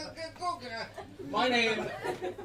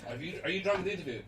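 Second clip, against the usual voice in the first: usual speaking voice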